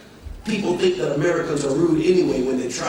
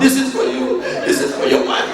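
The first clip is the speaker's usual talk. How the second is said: a hint of an eager host, higher; eager gift giver; 6 semitones higher, 4 dB louder than usual. Host handing gifts to the pastor, eager and higher. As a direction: press the gifts on him happily.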